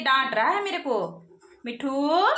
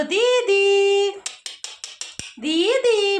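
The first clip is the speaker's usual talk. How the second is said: loud, high, drawn-out word; coaxing a parrot; 5 semitones higher, 4 dB louder, much slower than usual. Shown as a demonstration. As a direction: stretch it out slowly and loudly to be copied. Models word after "say it properly. (तोते को सिखाती ऊँची, लंबी आवाज़)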